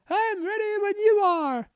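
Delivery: high, slow put-on lady's voice with a mock British lilt; Missus D voice. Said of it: "What a wonderful British accent Missus D has".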